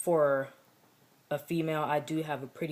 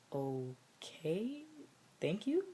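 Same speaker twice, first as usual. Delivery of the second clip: awkward thanks; 5 semitones higher, 5 dB quieter, much slower than usual. Retold or imitated. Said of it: "looking at him like".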